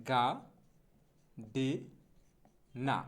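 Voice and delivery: গা, ডে, না each alone with long pauses; syllable by syllable. Shown as a demonstration. Teacher splitting gardener so learners follow, গা ডে না separately. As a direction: break it apart "ga ... de ... na", no r. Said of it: "আমি কিন্তু ভেঙে ভেঙে বলতেছি".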